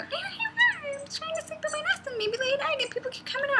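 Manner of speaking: frantic, fast falsetto bird squeal; very high, chattering comic voice; imitated mother finch. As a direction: complain like a tiny flustered bird. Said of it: "And she goes".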